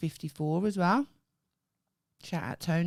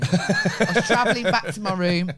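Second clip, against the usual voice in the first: laughing while telling, louder at the end; 4 semitones higher, 10 dB louder than usual. Real, not acted. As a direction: crack up mid-sentence. Funny lift story, laughing.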